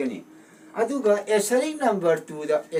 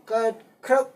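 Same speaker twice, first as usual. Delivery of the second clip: ꯀꯠꯈ꯭ꯔꯕ broken apart with a pause after the first syllable; two-syllable split; 5 semitones higher than usual. Shown as a demonstration. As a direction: separate the syllables of ꯀꯠꯈ꯭ꯔꯕ slowly. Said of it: "syllable ꯑꯅꯤꯗ". (ꯀꯠꯈ꯭ꯔꯕ ꯈꯣꯟꯊꯣꯛ ꯇꯣꯉꯥꯟꯅ ꯍꯥꯏꯕ)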